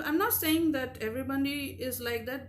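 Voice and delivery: ordinary talk, nothing marked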